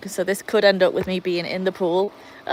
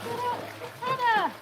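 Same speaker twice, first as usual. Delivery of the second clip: very high-pitched call to a dog; high wail at a disobedient dog; exasperated calling out; 6 dB quieter than usual. Real, not acted. Shooing the dog again.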